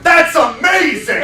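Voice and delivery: loud, high, slow shout through laughter; yelled that's amazing. Retold or imitated. Trainer cheering from the back of the show, a loud laughing yell. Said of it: "And I just went".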